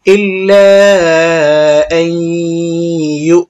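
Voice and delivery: ইল্লা drawn out, then আই hummed nasally into ইউ; long ghunnah recitation. Shown as a demonstration. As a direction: stretch ইল্লা and hold the hum before ইউ. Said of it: "এখানে গুণা করতে হবে".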